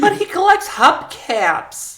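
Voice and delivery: very high-pitched, hammed-up wife voice; disbelieving wife. Wife defending Herbie to investigator, hammed-up disbelief. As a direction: defend your man in mock disbelief.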